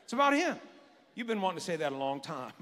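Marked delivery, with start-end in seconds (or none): 0.0-0.6 firm rising declaration; telling a neighbour. Shown as a demonstration. Pastor has the congregation tell their neighbour, building declaration. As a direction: say it to the person beside you, building. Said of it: "you just tell them".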